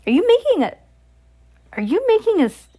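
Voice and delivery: high alarmed disbelief; rising alarmed question. Real, not acted. Mother alarmed at daughter.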